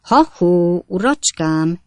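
loud hail; third call. A narrator's reading. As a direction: call out to the stranger. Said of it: "kiáltott fel Sam immár harmadszor".

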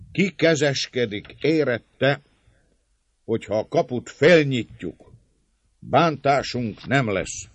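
high, loud, deliberate question. A narrator's reading. Servant leader asking attackers for a guarantee, loudly. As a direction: demand a guarantee for all to hear. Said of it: "De mondá fennszóval".

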